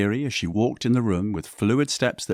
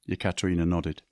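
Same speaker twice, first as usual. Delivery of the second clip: usual speaking voice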